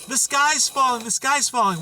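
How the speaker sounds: mildly shouted imitation of an alarmist; mock screaming. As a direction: cry it out like a panicked Chicken Little, half-hearted scream.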